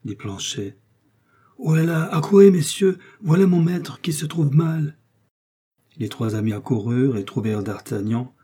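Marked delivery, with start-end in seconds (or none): 1.6-5.0 appel aigu et fort, paniqué; appel à l'aide. A narrator's reading. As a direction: crier pour appeler les messieurs. (Planchet affolé appelle les amis, à voix haute et aiguë)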